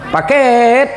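delivery man calling from his motorbike; courier calling out. Retold or imitated. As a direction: call the delivery at the door. Delivery man calls out at door.

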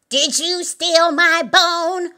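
loud, high, slow accusation; demanding. A narrator's reading. Old Mother Hubbard confronting Simple Simon, loudly demanding. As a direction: demand an answer word by word. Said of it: "Old Mother Hubbard demanded".

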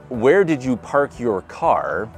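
normal talking voice

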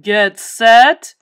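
very loud coach's race call; booming starter's command; bellow. A narrator's reading. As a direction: bellow it, swelling on the last word. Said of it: "bellowed the coach".

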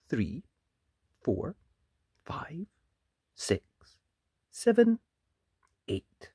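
ordinary delivery, nothing marked

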